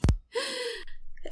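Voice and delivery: sharp high gasp of outrage; shocked high gasp. Acted. Wife gasps at the flirting woman, shocked gasp. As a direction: gasp in disbelief.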